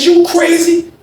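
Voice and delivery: lightly hammed-up angry inmate; high mock outrage. Cliff confronts the kitchen worker, in a high mock outrage.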